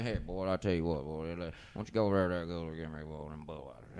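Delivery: a lightly voiced drunk uncle mumbling for a beer. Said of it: "he'll say".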